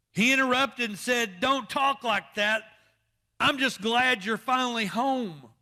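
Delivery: loud, rising preacher's cry; full-force preaching. Acted. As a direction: preach it out to the pews.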